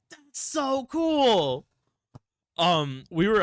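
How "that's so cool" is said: high, loud, drawn-out marvelling; awed excitement. Retold or imitated. Reliving the first shooting star, awed and excited. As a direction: stretch it out slowly in gushing wonder.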